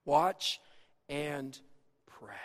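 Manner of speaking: soft, low and spaced out; hushed solemn words, a pause after each; quoted solemn charge. Retold or imitated. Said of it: "Jesus' words to Peter and the disciples".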